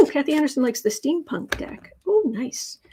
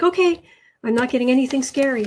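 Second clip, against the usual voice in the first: usual speaking voice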